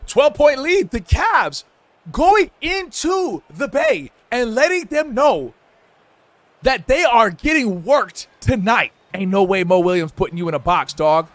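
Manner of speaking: ordinary talk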